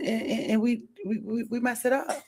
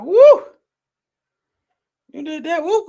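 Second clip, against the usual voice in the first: mock whoop; 6 semitones higher, 9 dB louder, slower than usual.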